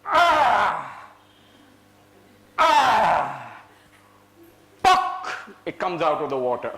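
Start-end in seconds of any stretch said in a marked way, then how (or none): 0.1-3.6 loud yelled cry of effort; shouted cry. Pretend. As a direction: bellow with the heave. Worm pulled out, shouts.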